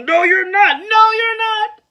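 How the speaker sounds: loud high shout. Acted. Echo shouting back.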